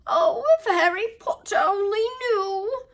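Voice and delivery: high, loud house-elf lament; groaned. A narrator's reading. Dobby lamenting to Harry Potter, groaning. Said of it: "Dobby groaned".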